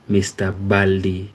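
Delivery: ordinary talk, nothing marked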